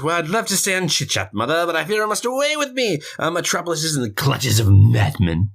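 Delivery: lightly put-on posh British voice, quick; a hint of a British gentleman taking his leave; mock British accent. Son to his mother, in a light mock British accent. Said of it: "she has him do the accent".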